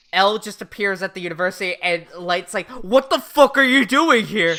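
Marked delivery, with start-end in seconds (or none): 2.7-4.6 loud, raised-pitch mock outrage; outraged shout. Light finds L at the university, outraged shout. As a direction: yell it as a shocked demand. Light startled by L's appearance.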